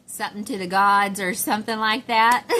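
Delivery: loud, laughing guess; amused fumbling. Real, not acted. Trying to recall kids' slang compliment, loud and amused. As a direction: belt out a botched guess, tipping into laughter.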